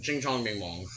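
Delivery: a deadpan, deliberately bad Chinese-accent caricature; mock Chinese accent. Friend's deadpan impression bit mocked on podcast, mock Chinese accent. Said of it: "Here's my impression of a Chinese person".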